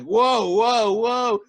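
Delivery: stunned whoa, whoa, whoa; mock alarm. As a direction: back off in mock shock. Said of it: "you're like".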